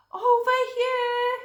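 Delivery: high, loud call of discovery; excited cry. Acted. Hare calling to Podgy about the footprint, excited cry. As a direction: shout out the find. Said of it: "cried Hare".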